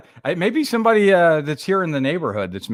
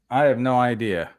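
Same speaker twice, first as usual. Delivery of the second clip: usual speaking voice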